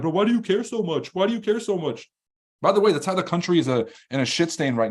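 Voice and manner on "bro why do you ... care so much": high put-on whine; mock whiny complainer. Mocking miserable people getting defensive: whiny put-on voice. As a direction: imitate the defensive whiner with scorn. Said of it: "being like".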